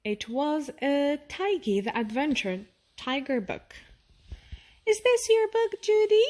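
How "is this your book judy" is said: put-on high, bright child voice; a child asking, up high; lightly voiced child. A narrator's reading. Friendly boy hands a book back to Judy, high child voice. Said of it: "he asked".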